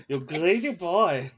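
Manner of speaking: put-on a laughing echo of the German accent; imitated German accent. GM echoes the player's mock German accent, laughing. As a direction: copy his mock German voice while laughing. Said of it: "Why did he turn German".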